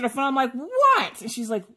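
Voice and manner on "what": high-pitched, irritated "what"; exasperated answer. Retold or imitated. Woman answering sister's untimely call, exasperated. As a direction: answer the phone fed up, voice jumping up.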